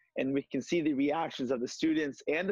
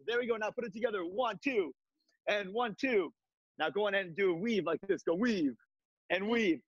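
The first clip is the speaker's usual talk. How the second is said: upbeat, pitched-up coaching patter; fitness class cues; 6 semitones higher than usual. Acted. Instructor leading host through a virtual kickboxing class, upbeat coaching. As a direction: cheer the class through each move.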